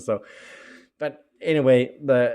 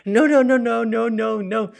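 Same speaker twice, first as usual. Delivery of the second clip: much higher and louder, a frantic string of no's; alarmed protest; 12 semitones higher, 11 dB louder than usual. Retold or imitated. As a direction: shout it in mock alarm to stop him. Technician's alarmed protest, retold.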